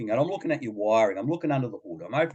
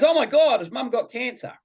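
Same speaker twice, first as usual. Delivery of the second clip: put-on high, loud panicked gasp of a young girl; imitating a worried daughter; a kid's sudden fear, much higher and louder; alarmed daughter; 12 semitones higher, 5 dB louder than usual. Daughter's alarmed question retold.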